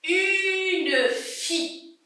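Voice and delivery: slow, drawn-out listening model; stretched-out word. Shown as a demonstration. Teacher helps pupils listen for F, stretched-out word. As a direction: draw out "Une" long and high, then "fille". Sounding out words to hear F.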